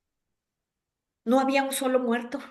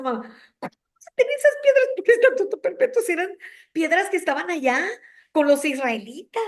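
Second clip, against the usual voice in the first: much higher puzzled voice; puzzled mother's question; 5 semitones higher than usual. Retold or imitated. Preacher voicing her mother's puzzled question about the stones. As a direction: voice a bewildered mother, pitch up. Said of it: "Y luego me decía".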